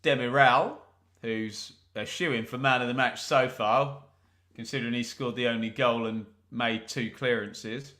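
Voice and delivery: ordinary delivery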